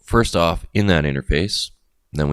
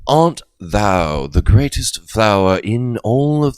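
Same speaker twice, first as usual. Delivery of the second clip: slow, grand, higher and louder olde English; mock old king's English; 6 semitones higher, 5 dB louder, slower than usual. Showing the king's language, slow and grandly hammed-up. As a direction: ham up a Shakespearean courtier. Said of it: "you know, the king's language".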